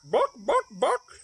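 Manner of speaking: high, clear hen cluck. Acted. Hen clucks clearly at the librarian. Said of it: "clucked the hen quite clearly".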